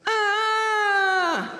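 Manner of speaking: a sudden loud high squeal of delight; high shriek. Retold or imitated. Fan squeals at [name]'s catchphrase, loud and high. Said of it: "she goes, ... like that".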